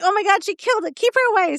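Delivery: high-pitched mock yell; retold yelling. Owner retelling panic over the dog's catch, mock yelling. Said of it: "then I'm yelling at her".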